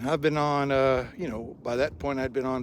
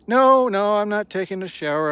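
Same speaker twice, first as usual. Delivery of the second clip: higher, louder hurried denial; flustered raised voice; 7 semitones higher, 9 dB louder than usual. Retold or imitated. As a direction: wave off the mistaken idea. Retold flustered refusal.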